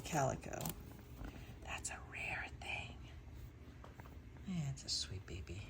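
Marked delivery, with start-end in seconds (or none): 1.6-5.6 soft tender whisper. Real, not acted. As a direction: breathe it to the kitten. Whispering to kitten.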